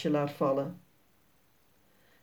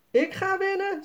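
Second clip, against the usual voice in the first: put-on much higher defiant child's voice; girl Sanne, competitive; 12 semitones higher than usual. A narrator's reading. Sanne answers Jasper that she will win, much higher. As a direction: claim the win like a cheeky little girl. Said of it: "zegt Sanne".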